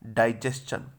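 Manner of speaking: डाइजेशन said "dai-JES-chan"; correct pronunciation. Shown as a demonstration. Teacher has students repeat it, careful "dai-jes-chan" for डाइजेशन. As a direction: model डाइजेशन with a clear "s-chan" ending. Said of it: "बोल लीजिएगा एक बार".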